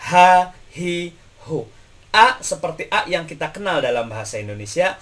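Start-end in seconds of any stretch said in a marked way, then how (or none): 0.1-1.7 "Ha, hi, hu" with a strong breathy h from the throat, louder; teacher models the throaty ha for students; Arabic ha demo. Shown as a demonstration. Teacher demonstrating to students, strong breathy h. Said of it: "Yang kedua adalah ha".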